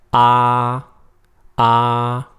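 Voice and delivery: AH held high and level; high flat tone. Shown as a demonstration. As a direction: hold AH on one high, even note. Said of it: "a high flat tone".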